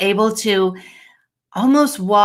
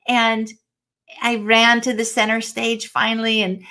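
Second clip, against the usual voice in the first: ordinary talk, nothing marked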